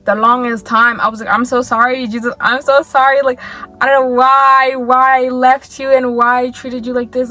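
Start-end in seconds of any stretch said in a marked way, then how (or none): 1.3-7.3 louder, higher pleading cry; pleading apology. Retold or imitated. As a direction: beg forgiveness with rising urgency. Apologizing to Jesus, retold prayer.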